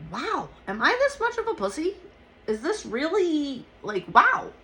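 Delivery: high-pitched, incredulous self-questioning; retold disbelief. Injured woman questioning her own toughness, incredulous.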